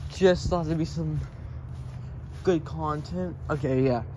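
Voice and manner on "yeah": normal talking voice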